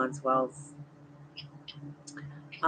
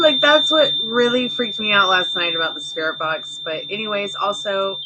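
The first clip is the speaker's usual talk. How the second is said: ordinary delivery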